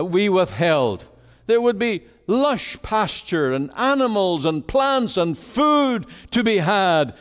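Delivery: high, impassioned preacher voice; pleading sermon delivery, pitched high; preaching at full force. Acted. Preacher pleading with listeners about Elijah, impassioned.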